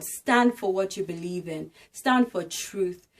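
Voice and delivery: usual speaking voice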